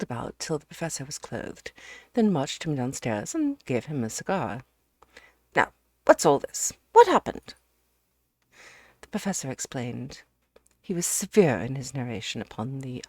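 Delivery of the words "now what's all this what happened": loud, rising to a high shout; hearty friend insisting on news; demanding Ukridge. Acted. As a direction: boom out the question.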